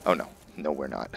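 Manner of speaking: ordinary delivery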